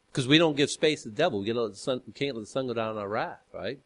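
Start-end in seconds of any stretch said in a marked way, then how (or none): none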